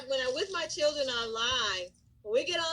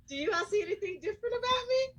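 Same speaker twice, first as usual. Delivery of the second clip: playful question; 8 semitones higher, much faster than usual. Retold or imitated. Teasing class about her antlers.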